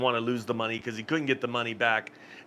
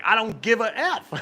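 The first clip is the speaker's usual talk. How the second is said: higher-pitched mock defiance; cocky, raised-pitch quoted comeback; defiant retort; 9 semitones higher than usual. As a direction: imitate a gambler snapping back, higher. Mimics angry gambler defying dealers.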